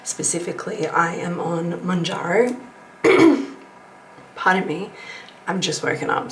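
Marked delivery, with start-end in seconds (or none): 3.0-3.6 loud throat clear. Real, not acted. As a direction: clear your throat sharply.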